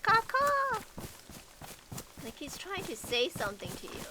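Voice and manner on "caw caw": high, loud crow-like caw; bird caw. Retold or imitated.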